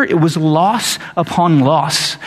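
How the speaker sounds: ordinary talk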